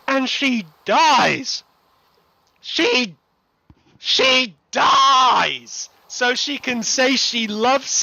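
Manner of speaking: high, loud wail with DIES dragged out; outraged rant. Real, not acted. Fan protesting a favourite character's death, outraged shouting. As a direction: shout it in disbelief, stretching the key word.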